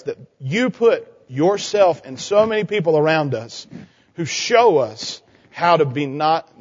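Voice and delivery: low, hushed prayer voice fading at the end; closing prayer. Acted. Pastor prays with the kids, low and hushed. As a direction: pray gently over the children.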